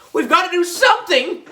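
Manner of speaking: high-pitched yell of a freaked-out schoolgirl; high, urgent yelp; panicked yell. A narrator's reading. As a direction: cry out for action, voice shooting way up. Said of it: "yelled Emily".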